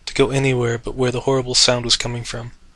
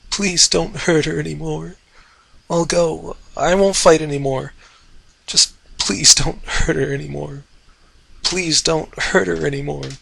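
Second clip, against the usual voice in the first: high, tearful pleading; as through tears; 4 semitones higher than usual. Acted. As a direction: plead as if crying, distant. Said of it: "spoken as through tears".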